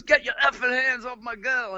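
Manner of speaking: the boyfriend snarls from the railing, moderately acted; angry boyfriend. Sid yells down at the narrator holding Nancy, imitated angry threat. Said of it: "He goes".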